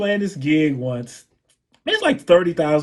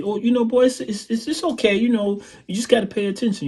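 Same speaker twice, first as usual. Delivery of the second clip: [name]; 8 semitones higher, faster than usual. Retold or imitated. Singer reassuring the scared drummer, imitated high voice. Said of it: "And he looks at me".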